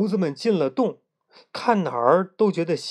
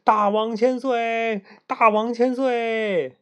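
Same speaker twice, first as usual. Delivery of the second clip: higher, slow drawn-out cheer; hailing cheer; 8 semitones higher, slower than usual. A narrator's reading. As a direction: monkeys hailing their new king, chanting slowly. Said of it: "立刻高呼".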